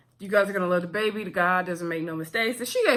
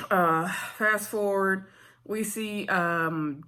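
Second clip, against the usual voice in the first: ordinary talk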